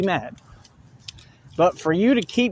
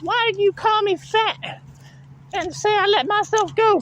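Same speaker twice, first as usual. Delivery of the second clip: much higher, whiny mock voice; mocking whine; 14 semitones higher than usual. Vlogger imitates a woman's complaint, whiny and far higher. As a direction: mimic her complaint in a shrill mock pitch. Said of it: "Talking about".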